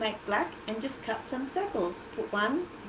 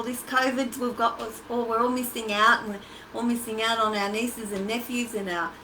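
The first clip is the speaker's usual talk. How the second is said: usual speaking voice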